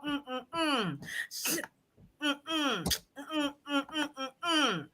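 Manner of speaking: soft stifled laugh through closed lips; muffled laughter. Real, not acted. Trying not to laugh at the comments, muffled giggling. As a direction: hold in the laugh, barely. Host breaks up over comments.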